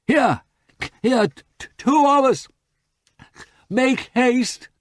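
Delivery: high, loud urgent calls; calling out. A narrator's reading. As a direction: hurry them with your shouts. Calling rescuers from the bog.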